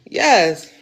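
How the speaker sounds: ordinary delivery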